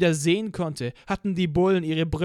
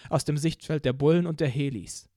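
ordinary talk

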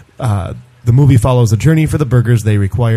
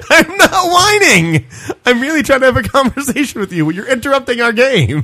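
put-on whiny child; 12 semitones higher than usual. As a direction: whine that you're not whining. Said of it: "Stop whining".

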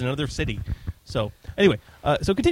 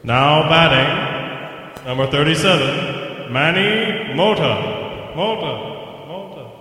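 ballpark announcer; 2 semitones higher, 3 dB louder, much slower than usual. Pretend.

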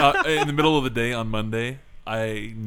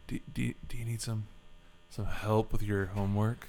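soft stammering admirer; simpering; 3 semitones lower, 9 dB quieter than usual. Pretend. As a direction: mumble the offer shyly. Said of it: "that fawning weirdo".

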